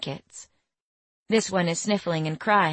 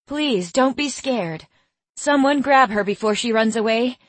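raised, urgent call; 5 semitones higher, 4 dB louder than usual. A narrator's reading. Harlow calls to the frightened woman and the others, raised urgent call. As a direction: call out to calm her and get help. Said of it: "Harlow calls out".

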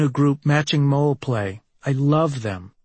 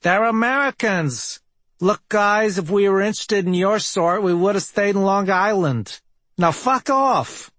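put-on honking New York accent, high and sneering; brash Long Island girl telling them off; 7 semitones higher than usual. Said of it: "says in a honking New York accent".

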